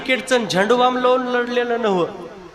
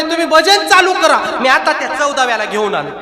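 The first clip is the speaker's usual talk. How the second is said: urgent concern; 6 semitones higher, 4 dB louder than usual. Acted. Tukaram worried, instructing the boys.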